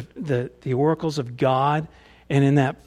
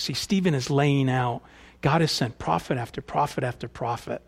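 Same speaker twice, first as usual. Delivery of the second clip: usual speaking voice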